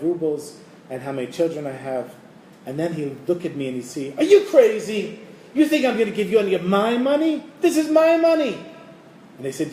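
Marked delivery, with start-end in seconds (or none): 4.2-8.6 high, loud, scornful shouting with a laugh; miser's mocking rage. Miser Yosele yelling at a beggar at his door, mocking fury. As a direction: sneer and bellow at the beggar.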